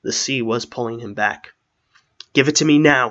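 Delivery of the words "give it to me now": loud, urgent order; shouted demand. A narrator's reading. Old man being pulled back by the sea, shouting. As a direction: demand it at once, forcefully. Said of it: "he shouted".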